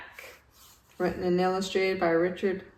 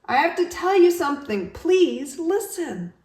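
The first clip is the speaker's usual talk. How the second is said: high, urgent plea slowing on please; pleading; 10 semitones higher, 5 dB louder than usual. Acted. Walrus begs Yak to listen, high and pleading. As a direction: beg him to listen.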